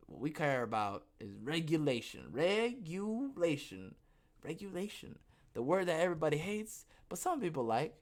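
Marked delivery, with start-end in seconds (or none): 2.3-4.0 "Regulation" stretched slowly, syllable by syllable, higher; drawn-out word. Shown as a demonstration. Host naming the big R word, slowly drawn out. 4.5-5.2 "Regulation" said softly and higher, at normal pace; hushed word. Shown as a demonstration. Host naming the word everybody hates, softly.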